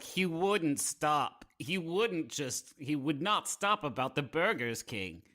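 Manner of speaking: normal talking voice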